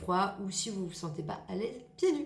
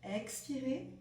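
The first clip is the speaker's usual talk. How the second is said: guided relaxation; 6 dB quieter than usual. Acted. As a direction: hush down and pace each breath cue. Leads breathing relaxation.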